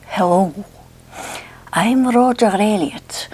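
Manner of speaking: put-on slow, drawn-out Scottish accent; Roger Elliott. Vlogger mimics her hypnosis tape narrator, Scottish accent impression. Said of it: "going to do some of Roger Elliott for you".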